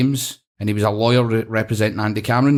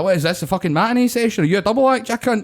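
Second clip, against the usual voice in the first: indignant rant; 10 semitones higher than usual. Retold or imitated. Retold roofer raging.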